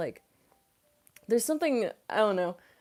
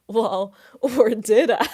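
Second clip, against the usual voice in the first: louder, slower, laughing through it; laughing tease; 5 semitones higher, 13 dB louder, slower than usual. Real, not acted. Joking with the chat, laughing loudly. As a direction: tease the viewer, then crack up. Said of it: "teasing joke".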